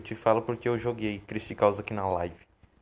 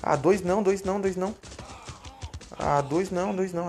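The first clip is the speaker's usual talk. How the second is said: panicked; 6 semitones higher than usual. Real, not acted. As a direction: beg in a rush of panic. Two enemies attacking.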